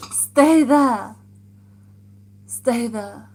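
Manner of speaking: loud call across the room; shouted command. Real, not acted. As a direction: shout it firmly so they stay. Calling out to someone.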